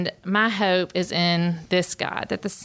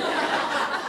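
breathy high-pitched giggle; high airy laugh; 10 semitones higher than usual. Real, not acted. Laughing at her daughter's answer, high and airy. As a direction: let a delighted laugh slip out.

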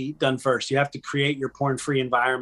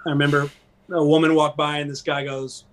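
ordinary talk

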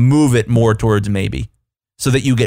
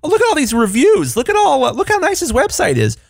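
much higher, amazed gushing; excited gushing. Retold or imitated. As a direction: gush like a delighted client. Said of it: "they're like".

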